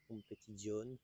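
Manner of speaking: Competizione said with a clumsy Italian accent; bad Italian accent. Shown as a demonstration. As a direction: roll out Competizione like a self-mocking Italian. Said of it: "avec mon accent italien qui est vraiment dégueulasse".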